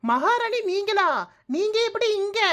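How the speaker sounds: high, mock-astonished greeting; mock-delighted surprise, voice pitched high. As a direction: pretend to be amazed by the queen. Mock surprise at mother-in-law.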